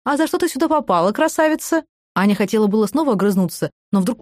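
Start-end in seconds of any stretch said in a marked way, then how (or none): 0.0-1.8 high, sugary flirting; sleazy flirt. A narrator's reading. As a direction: sweet-talk her slyly. Sergey leering flirt.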